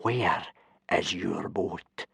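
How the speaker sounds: slow, soft menace; ominous calm before the storm; Gobber, dangerously calm. A narrator's reading. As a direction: ask it slowly and very quietly. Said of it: "his voice dangerously calm".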